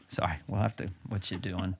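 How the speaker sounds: a breathy whisper trailing into a giggle; Biden whispering. Retold or imitated. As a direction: whisper it, half laughing. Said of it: "the lost my mind button".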